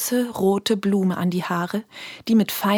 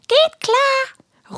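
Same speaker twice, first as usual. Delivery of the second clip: very high and louder, eager; squeaky creature calling; 5 dB louder than usual. Acted. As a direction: call it out cheerfully in a tiny voice. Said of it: "ruft Pupsi Jones". (sehr hoch und laut gerufen)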